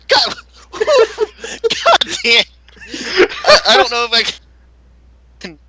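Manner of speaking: cracking up mid-sentence; helpless burst of laughter; laughing. Real, not acted. Breaks up laughing mid-reading.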